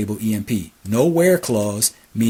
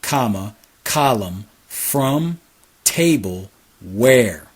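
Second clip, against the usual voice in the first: slow, spaced-out teaching read; slow dictation; much slower than usual. Shown as a demonstration. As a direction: spell out the syntax word by word, slowly. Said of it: "pay attention to the syntax".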